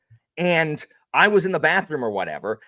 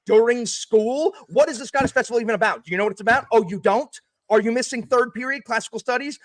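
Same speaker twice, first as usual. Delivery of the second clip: teacher voice; 7 semitones higher than usual. Retold or imitated. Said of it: "That's how I talk as a teacher".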